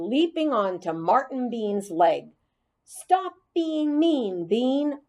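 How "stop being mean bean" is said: mild yell. Acted. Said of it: "I yelled at the top of my voice".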